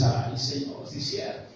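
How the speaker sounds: hushed, low mock admission; soft, breath-only acted sigh of agreement; meek concession. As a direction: murmur it like a humbled believer giving in. Mimics a listener meekly conceding.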